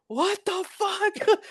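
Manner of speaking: very high, drawn-out falsetto shock; retold freak-out. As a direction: stretch it out slowly in a squealed mock freak-out. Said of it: "I freaked out".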